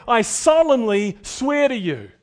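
slow, loud solemn swearing; solemn oath. Acted. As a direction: swear it with weight. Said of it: "It's the same way of saying".

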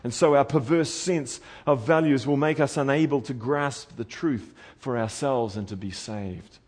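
ordinary talk, nothing marked

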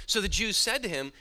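normal talking voice

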